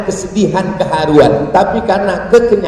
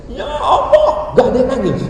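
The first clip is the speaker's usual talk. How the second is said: mock tearful prayer; 7 semitones higher than usual. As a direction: imitate trying to move a crowd to tears. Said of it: "untuk buat orang nangis".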